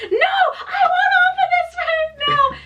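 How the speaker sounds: very high, slow mock scream; yelling after her. Sister yells after departing worker, acted scream. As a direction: wail it loudly, imitating a panicked sister. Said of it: "yells after her and is like".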